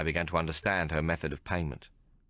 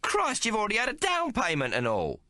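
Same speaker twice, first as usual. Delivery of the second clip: furious Maggie. A narrator's reading. Maggie cursing the tailor for cheating, furious. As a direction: tear into him.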